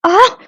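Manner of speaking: sharp high scream of fright; loud frightened cry; startled scream. Acted. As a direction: yelp in sudden shock.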